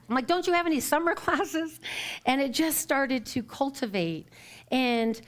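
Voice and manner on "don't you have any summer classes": high-pitched, indignant mock complaint; retold mock anger. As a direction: replay being mad, pitched up and half laughing. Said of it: "I was so mad".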